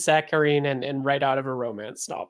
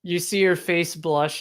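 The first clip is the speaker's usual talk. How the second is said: normal talking voice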